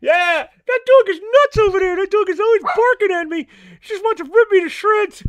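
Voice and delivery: a cheeky stoner puppet griping about the dog; Mister Rob puppet voice. Acted.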